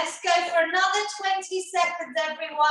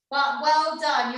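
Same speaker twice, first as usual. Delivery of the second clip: normal talking voice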